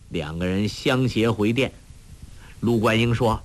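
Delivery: plain reading voice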